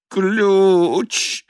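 high, drawn-out moaning word; chattering teeth. A narrator's reading. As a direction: moan it through chattering teeth. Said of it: "замычал инженер, клацая зубами".

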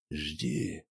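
hushed, low command; whispered order. A narrator's reading. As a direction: breathe the order very softly. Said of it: "шёпотом приказал Бурцев".

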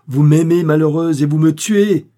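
loud, high, impassioned reproach; anguished outburst. A narrator's reading. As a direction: cry out as a lover beside himself. Frantic lover reproaching her.

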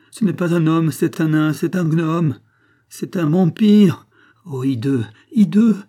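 loud, high outcry, mounting in horror; horrified revulsion. Acted. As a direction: denounce a monster in frantic disgust. Horrified at the man touching son.

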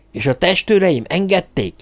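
high, incredulous question; indignant leap in pitch; outraged. A narrator's reading. Hírek asking if his guards allowed it, outraged.

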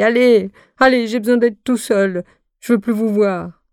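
hammed-up wounded sulk, pleading to be left alone; whiny tearful voice. A narrator's reading. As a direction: whimper it out like a hurt big baby. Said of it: "dit d'une voix pleurarde".

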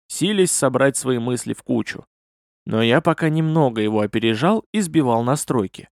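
plain reading voice

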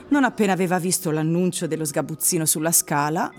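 normal talking voice

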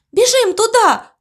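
high, loud rallying cry; shouted. A narrator's reading. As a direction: shout to get everyone running. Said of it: "крикнула Морковка".

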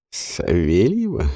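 low, slow sceptical question; doubtful repeat. Acted. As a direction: weigh the name with doubt. Ворон переспрашивает. (недоверчивый переспрос)

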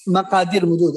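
usual speaking voice

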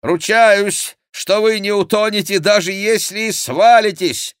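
high, loud guarantee; shouted reassurance. A narrator's reading. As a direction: assure him loudly from horseback. Chicot shouts reassurance to monk. (громкое высокое заверение)

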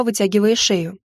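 usual speaking voice

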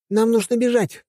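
put-on high urgent girl's cry; shout. A narrator's reading. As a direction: Helga crying out to run. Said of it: "крикнула Хельга".

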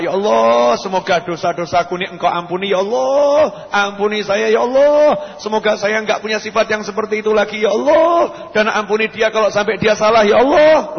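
pleading, rising on each call to God; fervent prayer. Acted. Preacher demonstrating an insulted person praying for forgiveness, fervent. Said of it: "saya mau doa".

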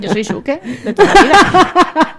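laughing through the words, rising high and loud; real laughter breaking into a joke. Real, not acted. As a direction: burst out laughing as you say it. Joking reply amid laughter.

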